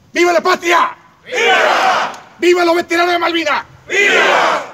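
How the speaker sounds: high, loud patriotic cheer; booming balcony speech. Real, not acted. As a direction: shout it to the crowd. Patriotic closing cheers at ceremony.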